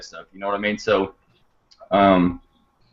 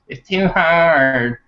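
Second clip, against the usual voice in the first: slow, higher-pitched mock complaint; imitating a daunted complainer; mock whining; 5 semitones higher, much slower than usual. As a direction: drag it out like a whiner, pitched up. Voicing intimidated people's excuse.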